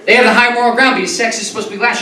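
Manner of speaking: normal talking voice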